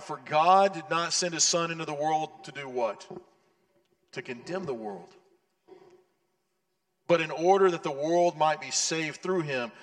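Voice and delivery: scripture reading. Acted. Reads scripture in full voice.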